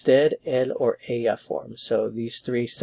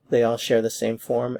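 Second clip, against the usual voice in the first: ordinary delivery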